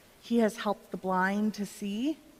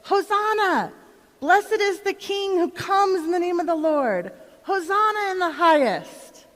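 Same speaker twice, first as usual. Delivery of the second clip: high, loud cry of praise; joyful shout; 8 semitones higher, 5 dB louder than usual. Retold or imitated. Crowd shouting praise as Jesus rides by, joyful shout. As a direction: cheer it out to the passing king. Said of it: "we shouted".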